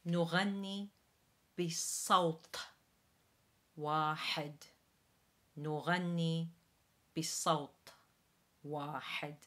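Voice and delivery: Arabic repetition for learners; Arabic model. Shown as a demonstration. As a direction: repeat نغني بصوت واحد. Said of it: "So once again".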